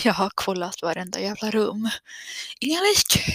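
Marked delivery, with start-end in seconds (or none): 2.6-3.2 high relieved exclaim. Real, not acted. Relieved no corpses.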